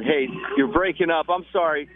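normal talking voice

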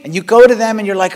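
ordinary delivery

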